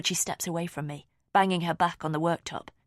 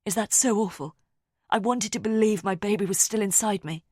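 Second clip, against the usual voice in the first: louder, pleading; upset outburst; 4 semitones higher, 5 dB louder than usual. A narrator's reading. Rosamond pleads with narrator, louder and upset. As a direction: push back, raw and loud.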